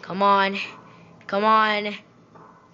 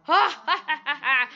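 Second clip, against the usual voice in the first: triumphant ha ha ha; gloating laugh; 5 semitones higher than usual. Real, not acted. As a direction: laugh in the game's face. Gloating after solving the level.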